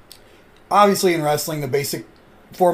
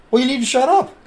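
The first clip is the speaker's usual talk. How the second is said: higher, louder snide brush-off; heel attitude; 8 semitones higher, 4 dB louder than usual. Retold or imitated. Wrestling heel telling the fan to shut up, snide. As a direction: cut the fan down as the bad guy. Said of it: "playing a character".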